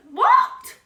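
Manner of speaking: very high, loud disbelieving squeal; shocked. Real, not acted. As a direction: shriek at the bunny eating the evidence. Sees the bunny eat the strawberry.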